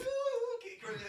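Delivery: very high, drawn-out mascot call; cereal mascot cry. Acted. Imitating the Cookie Crisp mascot for a friend, high drawn-out cry. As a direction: stretch it out high and soft like a far-off mascot.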